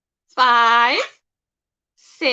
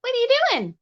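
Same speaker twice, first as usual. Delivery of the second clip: high pet-talk voice; 7 semitones higher, faster than usual. Real, not acted. Teasing uncooperative parrot.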